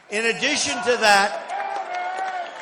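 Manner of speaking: slow, stretched-out phrase; drawn-out emphasis. Real, not acted. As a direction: drag it out for effect. Repeats phrase, first drawn out.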